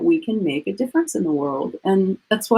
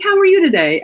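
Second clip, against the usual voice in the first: higher, bright helpful store clerk voice; bubbly salesgirl; 11 semitones higher, 5 dB louder than usual. Retold or imitated. Best Buy clerk greeting an upset customer, higher bubbly helpfulness. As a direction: lift it up like a cheerful helper. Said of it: "She's like".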